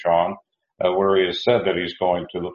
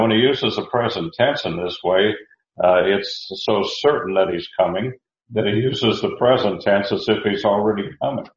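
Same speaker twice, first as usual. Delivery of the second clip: normal talking voice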